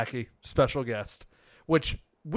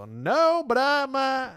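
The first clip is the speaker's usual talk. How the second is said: put-on Texas country accent; 12 semitones higher, much slower than usual. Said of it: "strong country accent".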